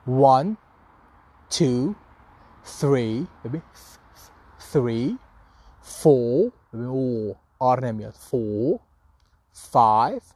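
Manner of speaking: counting in a careful British accent, one number at a time; British counting demo with pauses. Shown as a demonstration. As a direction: model each number slowly in British English. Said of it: "توی لهجهٔ بریتیش اعداد به این شکل".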